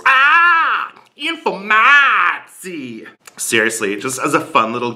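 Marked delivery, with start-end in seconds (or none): 0.0-0.9 "Ah" held long, very high; mocking, stretched-out "Ah"; exaggerated long A. Shown as a demonstration. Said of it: "the A goes on for five syllables". 1.2-3.1 "Informatie" slow and high, A stretched out; mock Dutch "Informatie" with an endless A; exaggerated long A. Shown as a demonstration. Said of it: "the A goes on for five syllables".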